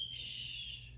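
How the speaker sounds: breathy hush; whispered shush. Acted. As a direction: hush her softly. Lover hushing her.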